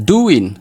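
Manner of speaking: DOING as "doo-win", high then falling; silent G. Shown as a demonstration. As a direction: drop the g in doing. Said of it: "Gaa akka hin jirreetti ilaaltanii". ("doing" gaa malee dubbisuu)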